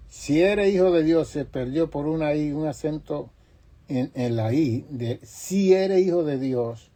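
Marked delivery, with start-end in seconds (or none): 0.1-1.3 higher, louder mocking taunt; mocking thief. Mocking thief taunts Jesus from the cross, loud sneer. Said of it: "el que se estaba burlando". 5.2-6.9 higher, louder, slow mocking taunt; mocking thief. Mocking thief taunts Jesus again, slow loud sneer. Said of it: "el que se estaba burlando".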